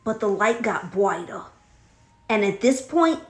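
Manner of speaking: ordinary talk, nothing marked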